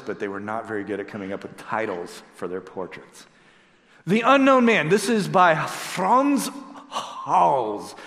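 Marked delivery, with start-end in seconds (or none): 4.1-8.0 a hint of a Dutch accent; lightly put-on Dutch accent. Shown as a demonstration. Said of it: "That's my best Dutch accent".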